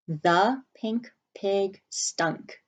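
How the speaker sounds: very slow, word by word; slow dictation. Shown as a demonstration. Teacher dictating the sentence slowly to the class. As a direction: say each word slowly for writing. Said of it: "And our sentence is".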